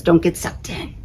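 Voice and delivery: faint whispered warning; tiny whispered star. Acted. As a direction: breathe it like a distant little star. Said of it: "the little star says".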